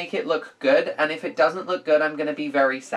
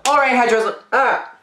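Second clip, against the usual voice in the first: excited; 6 semitones higher, 4 dB louder than usual. Pretend. Mock excited hairdresser greeting.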